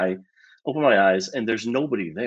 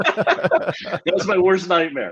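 high, slow words through laughter; laughing; 9 semitones higher, 3 dB louder, much slower than usual. Real, not acted. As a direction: laugh helplessly while confessing. Laughing at the irony of it.

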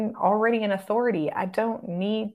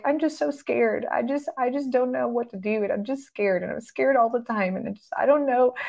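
scared person; 5 semitones higher than usual. Pretend. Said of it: "So if they're like".

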